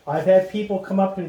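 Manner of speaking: ordinary delivery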